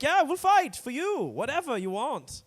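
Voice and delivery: very high, slow, overeager yes; mock eager Germany. Mocking Germany promising to fight for everyone, squeaky and eager.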